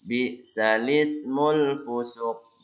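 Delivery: "Bi salismul" with the hamza vowel moved onto the lam, slow; naql. Shown as a demonstration. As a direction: carry the vowel across in "salismul". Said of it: "nakol ya, pindah".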